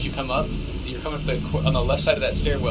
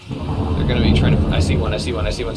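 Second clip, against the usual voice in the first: urgent callout; 8 dB louder than usual. Real, not acted. Spotting an enemy, calling out.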